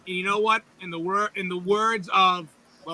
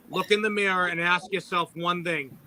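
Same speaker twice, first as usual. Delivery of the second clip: ordinary delivery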